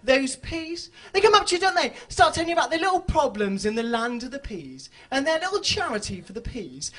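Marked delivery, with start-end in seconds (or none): none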